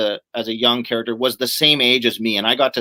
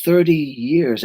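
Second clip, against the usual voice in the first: lightly acted film monologue; slow, brooding recitation with pauses; a hint of a movie warrior reminiscing; Conan; slower than usual. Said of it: "Conan's sitting there, and he says".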